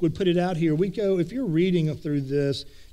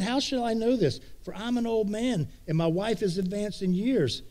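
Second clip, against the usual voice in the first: put-on higher, anxious old man's protest; freaking out; 5 semitones higher than usual. Priest doubting the angel, anxious higher voice. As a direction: voice Zechariah rattled and doubting. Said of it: "so he's freaking out".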